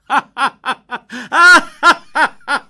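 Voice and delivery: big, high hearty laugh; loud laugh. A narrator's reading. Wonka laughs out loud at the stunned grandpa. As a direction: let out a booming delighted laugh. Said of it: "آقای ونکا با صدای بلند خندید".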